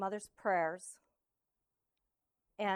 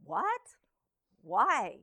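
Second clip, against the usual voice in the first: confused; 3 semitones higher, 6 dB louder than usual. Retold or imitated. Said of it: "my mother's like".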